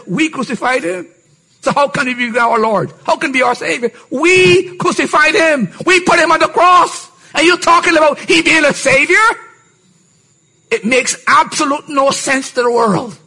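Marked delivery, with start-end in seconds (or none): 0.1-9.3 scornful Romans. Retold or imitated. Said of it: "the Romans said".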